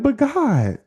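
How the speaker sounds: a pleading outburst; protest. Retold or imitated.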